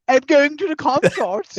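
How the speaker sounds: a snooty voice mocking the royal consort; posh voice. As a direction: put on a hammed-up posh toff. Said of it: "it's a posh show where people perform".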